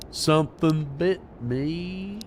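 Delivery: slow, drawn-out stab at a Southern drawl; Forrest Gump voice. Retold or imitated. Gamer trying a Forrest Gump impression in slow Southern drawl. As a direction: drag the words out like a simple Southern man. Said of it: "No, I can't".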